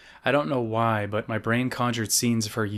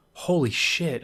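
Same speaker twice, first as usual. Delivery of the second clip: higher, gasped amazement; 5 semitones higher, slower than usual. A narrator's reading. Boy arriving in the new neighborhood, gasping. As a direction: say it with a gasp of awe. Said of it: "I said with a gasp".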